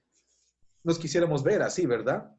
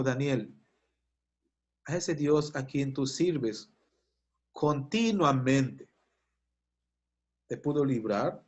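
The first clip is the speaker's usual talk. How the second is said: slow, drawn-out calling into the den; slow solemn question; 3 semitones lower, slower than usual. Retold or imitated. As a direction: ask slowly, weighing each word. King calls into lions' den. (pregunta lenta y solemne)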